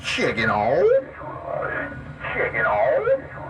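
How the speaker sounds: echoey CB radio voice. Shown as a demonstration. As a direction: talk into the mic with echo. CB radio echo test.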